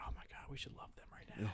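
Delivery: breathy imitation whisper, half a laugh; mock whisper. Fans whispering excitedly about the hosts they just spotted, mock whisper. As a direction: hush it like gushing fans whispering nearby. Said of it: "we heard you whispering".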